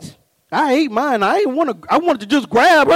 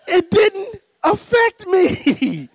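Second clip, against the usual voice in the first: slow, high wail drawn out; hammed-up tearful whine; mock crying; 6 semitones higher, much slower than usual. Husband wailing that the book left him untouched, mock crying.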